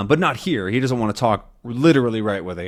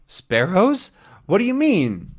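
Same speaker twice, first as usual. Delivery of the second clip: puzzled question; 8 semitones higher than usual. Acted.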